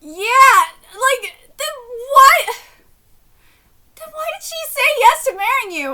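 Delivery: loud, high, laughing outrage; can't believe what she just read; laughing disbelief. Real, not acted. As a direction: blurt it out, stunned and laughing. Shocked reaction to story.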